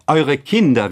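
high, loud joyful announcement; exclaimed call. A narrator's reading. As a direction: call it out to the parents. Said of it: "riefen sie aus".

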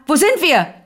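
höher und lauter gerufen, nachgeahmt; nachgemachter Ruf. Retold or imitated. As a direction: ruf es wie der Mann in der Bahn. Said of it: "der gerufen hat in der Bahn". (nachgeahmter Ruf des blinden Mannes)

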